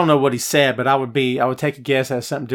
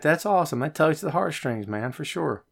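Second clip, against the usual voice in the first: normal talking voice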